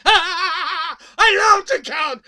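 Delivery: slow, loud, high vampire laughing; vampire count. Acted. Vampire count gloating to listeners, laughing. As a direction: gloat like a count who loves numbers.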